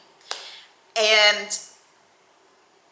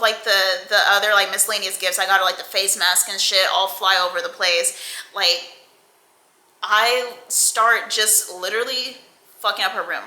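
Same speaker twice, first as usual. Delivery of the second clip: usual speaking voice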